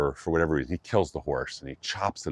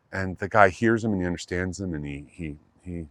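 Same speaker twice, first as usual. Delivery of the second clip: usual speaking voice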